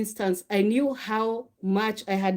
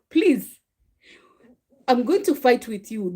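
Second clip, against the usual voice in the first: exasperated cry; 2 semitones higher, 3 dB louder than usual. Retold or imitated. Mother's retold clash with son.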